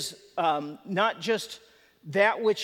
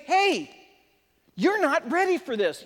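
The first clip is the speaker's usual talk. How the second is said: higher and louder, firm and commanding; authoritative warning; 7 semitones higher, 4 dB louder than usual. Retold or imitated. Jesus warning Nicodemus he's not ready, a raised commanding voice. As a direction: call him out with authority. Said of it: "coming from an authoritative source".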